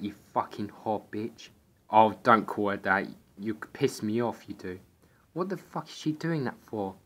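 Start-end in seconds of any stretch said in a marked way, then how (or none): none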